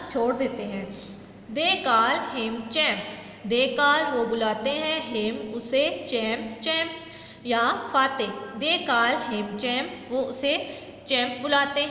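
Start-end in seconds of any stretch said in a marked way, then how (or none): 1.6-3.0 very slow, every word spaced out for the class; slow dictation read. Shown as a demonstration. Teacher reading a sentence to students, very slow and deliberate. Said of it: "teacher reads the sentence aloud". 8.6-9.9 very slow, every word spaced out for the class; slow dictation read. Shown as a demonstration. Teacher reading a sentence to students, very slow and deliberate. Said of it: "teacher rereads the sentence".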